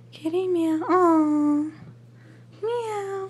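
high squeaky cat meow; playful meow. Pretend. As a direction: meow like a kitten. Imitating the cat.

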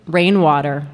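normal talking voice

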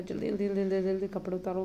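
rapid-fire jaldi jaldi, fast and impatient; hurried scolding. Retold or imitated. Mother rushing her daughter to undress, fast impatient scold. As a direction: rattle it off to rush the kid. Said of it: "फटाफट मैंने कहा".

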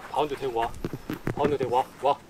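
normal talking voice

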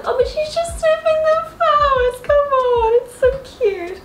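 high, loud, gushing squeal; fond cooing plea. Real, not acted. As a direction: squeal in the cat's defence. Pleading fondly for her cat.

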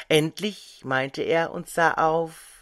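usual speaking voice

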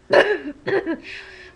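loud pretend cough rising at the end; fake cough. Patient coughs when called next, loud pretend cough. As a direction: hack out a sick cough.

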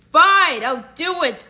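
loud, reluctant agreement; grudging. Acted. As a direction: give in with a groan.

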